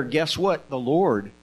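plain reading voice

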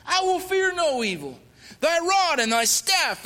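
high, loud fervent recitation of the psalm; preaching scripture. Acted. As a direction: proclaim it like a preacher at full force.

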